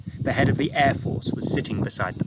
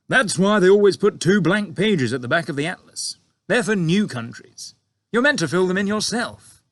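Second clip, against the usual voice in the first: a touch of a broad grin; grinning pilot; 8 semitones higher, 6 dB louder than usual. A narrator's reading. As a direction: pilot cheekily explaining to the chiefs, grinning. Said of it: "still grinning broadly".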